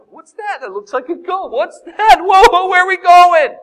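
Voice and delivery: rising from puzzled to loud, high alarm; frightened shouts. Acted. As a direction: panic building as the boat lurches. Disciples frightened in boat.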